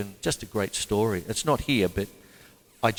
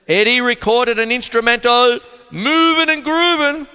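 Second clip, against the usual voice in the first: loud, high-pitched title announcement; announcer read; 12 semitones higher, 10 dB louder than usual. Real, not acted. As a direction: belt out the track title like a showman. Reads notes, announcing track title.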